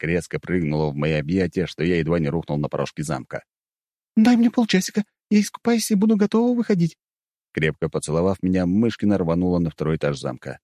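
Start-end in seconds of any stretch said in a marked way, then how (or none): 4.2-7.0 put-on high bubbly excited girl; bubbly sorority girl. A narrator's reading. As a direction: chirp it, still thrilled. Yulia still excited. (радостная весёлая девушка)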